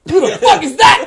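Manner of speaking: high, loud incredulous yell; mock shout. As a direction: bark it like a startled crowd. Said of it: "everybody's like".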